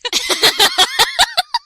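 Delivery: loud, high burst of laughter; screaming with laughter; shrieking laugh. Real, not acted. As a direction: cackle loudly.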